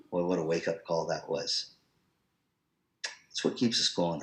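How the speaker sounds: ordinary delivery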